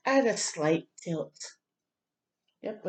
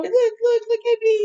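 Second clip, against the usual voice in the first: put-on high, loud, eager call of a little boy; 12 semitones higher, 7 dB louder than usual. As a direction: call out like a kid desperate to be noticed. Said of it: "he's saying".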